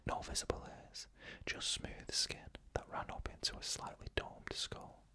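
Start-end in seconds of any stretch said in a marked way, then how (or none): none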